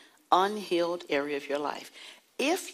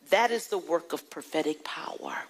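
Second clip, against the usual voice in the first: ordinary talk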